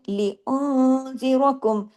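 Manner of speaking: slow لِأُنذِرَكُمْ with a hidden nasal noon; full ghunna. Shown as a demonstration. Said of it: "اخفا حقیقی، غنہ کاملہ".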